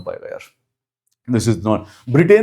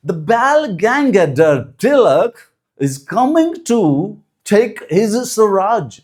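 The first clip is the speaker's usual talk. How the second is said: slow, high mock British accent drifting Texan; imagine Tilak spoken of in a put-on British accent; 7 semitones higher, much slower than usual. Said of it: "थोड़ा सा American टेक्सन accent भी आ गया". (धीमा, ऊँचा नकली British लहजा)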